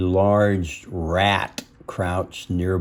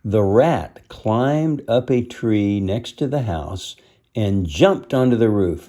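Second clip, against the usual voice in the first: ordinary delivery, nothing marked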